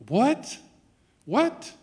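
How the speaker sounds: high, loud rising question; incredulous. Acted. Preacher astonished at forgiveness from the cross, incredulous. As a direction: burst out in acted disbelief.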